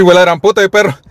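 normal talking voice

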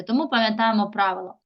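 ordinary delivery

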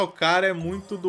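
ordinary delivery

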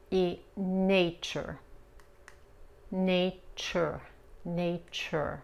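"nature" with an English R, drawn out; English R demo. Shown as a demonstration. Teacher drilling "nature" for the class, slow. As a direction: model "nature" slowly. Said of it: "Otra vez la R".

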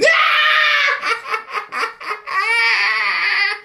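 high, shrieking villain laugh; villain cackle. Retold or imitated. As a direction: cackle like the Joker. Villain laugh after the line.

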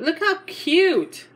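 slow, high, adoring Look how cute; gushing. Real, not acted. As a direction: stretch out Look how cute like melting over it.

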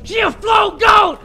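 high, loud screams breaking into rapid pleading; panicked shouting. Real, not acted. Player being chased by a floating enemy, panicked yelling. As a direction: yell in panic, then beg over and over.

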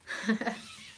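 brief high chuckle; short chuckle. Real, not acted. Mum amused by her own accent slip, short chuckle. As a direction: let out a quick little laugh.

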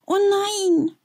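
a high, drawn-out wail. A narrator's reading. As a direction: moan it in dismay. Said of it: "jammert Sven". (hoch und klagend jammern)

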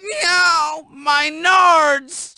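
high, pained squeal. Acted. Hit below the belt.

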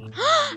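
sharp high gasp of outrage; offended gasp. Acted. Foster mom insulted by Ella, high shocked gasp.

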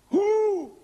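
high, loud single word; shouted challenge. Acted. Preacher demanding who the guilty man was, loud and high. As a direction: fire the question at them.

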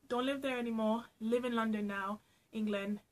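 ordinary talk

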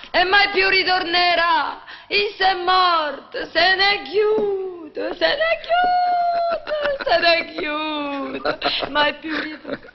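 slow wailing that breaks into laughter; overdone dirge, cracking up; laughing lament. Acted. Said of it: "non doveva essere la scena più commovente?".

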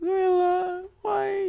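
high, thin-volume hammed-up whimper; mock tearful lament. Player asking why his gorilla died, mock tearful. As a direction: lament it high, pretending to weep.

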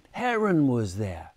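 plain reading voice

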